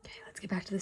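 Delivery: breathy whisper to the class, quick. Real, not acted. Teacher to her class, a quick whisper. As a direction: hush down and slip back into the story.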